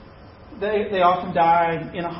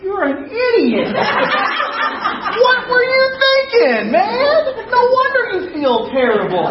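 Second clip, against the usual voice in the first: laughing scold; 7 dB louder than usual. Retold or imitated. Paramedic scolding him, laughing.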